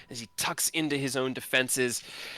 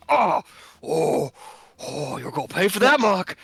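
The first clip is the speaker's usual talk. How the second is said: angry threat; 7 semitones higher, 6 dB louder than usual. Acted. Eric furious after fall.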